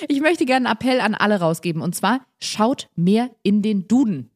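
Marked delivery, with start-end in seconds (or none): 2.4-4.3 slow, spaced-out proclamation; deliberate, weighty slow delivery; solemn appeal. Real, not acted. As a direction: announce it word by word as an appeal.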